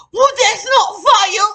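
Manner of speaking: high, slow mocking whine; mocking high whiny voice; whiny coworker. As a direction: mimic her petulant protest. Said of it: "And she said".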